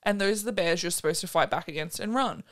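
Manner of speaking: normal talking voice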